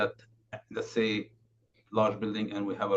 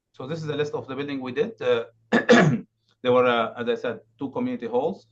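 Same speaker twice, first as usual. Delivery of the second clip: raised, louder presenting voice; speaking up; 4 semitones higher, 8 dB louder than usual. Real, not acted. Presenter restarting the list after being asked, speaking up. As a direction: project so the listeners can hear. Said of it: "just speak a little louder".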